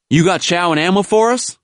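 high-pitched soldier's shouted question; hailing NCO. A narrator's reading. NCO asking the driver for supplies, hailing. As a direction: call across to the driver.

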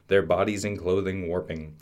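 plain reading voice

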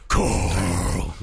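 exaggerated Batman-style growl; hammed-up gruff zombie-show hero; Rick. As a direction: growl the name long and gruff. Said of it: "He's a Batman voice".

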